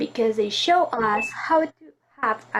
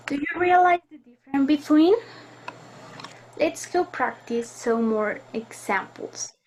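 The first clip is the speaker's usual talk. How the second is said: normal talking voice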